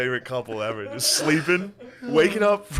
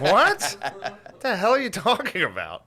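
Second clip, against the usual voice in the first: incredulous laughing protest; mock disbelief; 7 semitones higher, slower than usual. Couple questioning the devil intruder in laughing disbelief.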